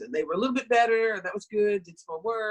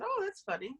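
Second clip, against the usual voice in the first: mock surprised remark; 13 semitones higher, 8 dB quieter than usual. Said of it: "I said".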